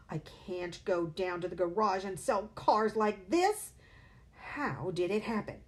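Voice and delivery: panicked outburst. A narrator's reading. Father panicking at mirror.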